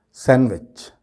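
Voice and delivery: "सैंडविच" joined as SAN-vech; fluent "सैंडविच", silent d; correct pronunciation. Shown as a demonstration. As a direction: say "सैंडविच" without the d. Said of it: "सही किस तरह से बोला जाएगा".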